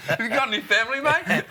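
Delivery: high, laughing, mock-menacing question; laughing repeat. Repeating Rodney Marsh's sledge to the guest, high and laughing. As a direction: savour the line through laughter.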